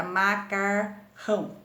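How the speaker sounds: MA - CAR - RÃO with gaps; teacher sounding out MA-CAR-RÃO; split between the R's. Shown as a demonstration. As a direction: say macarrão divided as MA, CAR, RÃO. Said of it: "tem que separar os dois R's".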